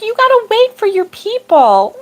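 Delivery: imitating the husband's high, gentle scolding. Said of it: "I got scolded in a gentle way".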